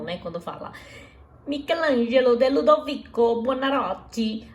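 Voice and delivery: normal talking voice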